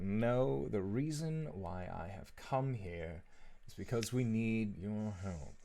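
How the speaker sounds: whispered, reluctant; mumbled admission. Acted. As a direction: barely get the words out. Gideon mumbles the request.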